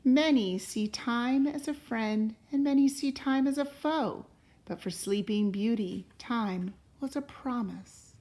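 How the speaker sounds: unhurried read-aloud voice, rising on the opening lines; storybook narration. A narrator's reading. Parent reading a fairy tale to a child, gentle storytelling. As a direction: tell it like a bedtime story to a child. Reads a picture book aloud.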